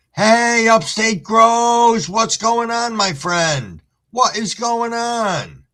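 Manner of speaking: high, slow stretched hello; excited greeting. Real, not acted. Streamer greets a viewer who just popped in, high and drawn out. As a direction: greet a friend with a lift.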